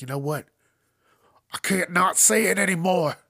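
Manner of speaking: mock pastor outburst. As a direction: ham up a preacher who can't hold back. Mocking pastor yelling in church.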